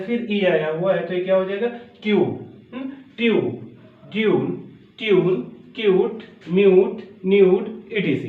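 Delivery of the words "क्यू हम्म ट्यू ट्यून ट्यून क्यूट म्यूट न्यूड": ट्यून, क्यूट, म्यूट, न्यूड with a y-glide before oo; teacher's word list, क्यूट as kyoot, pauses between words; yoo vowel. Shown as a demonstration. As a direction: model each word separately with a clear "you" sound. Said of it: "देखो यू sense निकल रहा है".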